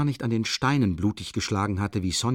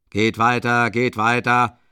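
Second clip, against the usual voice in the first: policeman shouting; 2 semitones higher, 6 dB louder, slower than usual. A narrator's reading. Policeman driving crowd away.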